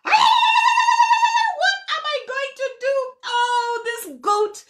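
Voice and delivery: high, loud wailing over the goat; lamenting cry. A narrator's reading. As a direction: cry out in mock grief. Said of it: "he lamented and he cried".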